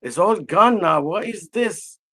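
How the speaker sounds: loud, high frustrated cry to himself; exasperated, loud inner outburst. Retold or imitated. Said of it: "I was really frustrated".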